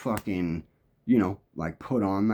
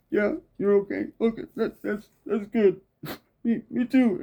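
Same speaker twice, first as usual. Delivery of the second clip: high, uneasy reply, voice pitched up; nervous stranger; 11 semitones higher than usual. Retold or imitated. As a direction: sound spooked but trying to be polite.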